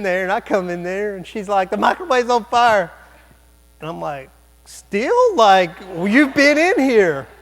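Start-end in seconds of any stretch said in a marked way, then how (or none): 1.7-2.9 higher, louder mock alarm; retold alarm. 4.6-7.3 high, loud, drawn-out incredulity; exasperated disbelief. Retold or imitated. Exasperated retort to useless bystander.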